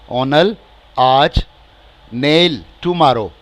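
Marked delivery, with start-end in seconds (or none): 0.1-1.4 very slow model word. Shown as a demonstration. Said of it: "teacher models word".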